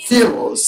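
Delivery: ফিউলস as "fyools", set apart; English pronunciation. Shown as a demonstration. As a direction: model ফিউলস with a fyoo glide and a fall. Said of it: "এই উচ্চারণটা মনে রাখবা". (ফিউলস, ইংরেজি ধাঁচে ফিউ-লস উচ্চারণ)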